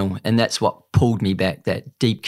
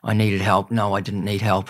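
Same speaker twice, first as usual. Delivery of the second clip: ordinary talk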